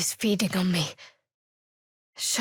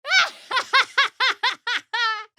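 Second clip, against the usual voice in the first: wild high cackle; 12 semitones higher, 5 dB louder than usual. Acted. Combat, gleeful outburst.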